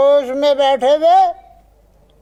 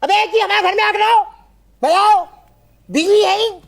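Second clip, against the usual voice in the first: high, strained indignant complaint; angry tirade; 7 semitones higher than usual. Acted. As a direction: rail at him in a sustained high pitch. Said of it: "अपना गुस्सा क्यों उतार रहे हैं". (ग़ुस्से भरी ऊँची आवाज़ में शिकायत)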